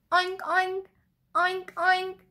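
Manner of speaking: high, loud "oink oink"; short, high imitated pig oinks; playful pig oink. Storyteller showing children what a pig says, high playful oinks. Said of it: "A pig says".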